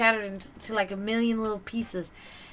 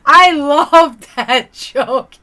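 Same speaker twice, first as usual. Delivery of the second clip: slow, louder, laughing through it; 4 semitones higher, 14 dB louder, much slower than usual. Real, not acted. Telling the chat her favourite joke, laughing loudly. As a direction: laugh out the words.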